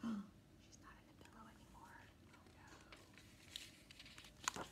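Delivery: breathy gasp then soft whisper. Real, not acted. Mother whispering to her child between pages. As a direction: whisper it as a secret aside. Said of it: "unvoiced whisper".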